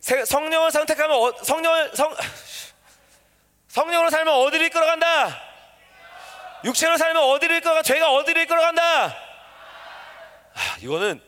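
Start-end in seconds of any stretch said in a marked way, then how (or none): 0.0-9.1 high, driving call-and-response; louder rousing shout. Shown as a demonstration. Preacher urging congregation to answer louder, high insistent shout. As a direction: push the crowd to shout back. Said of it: "더 크게".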